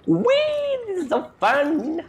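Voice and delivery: very high, giggly delight; gleeful squeal. Real, not acted. Player enjoying the ride back, gleeful high squeal. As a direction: squeal like you're on a fun ride.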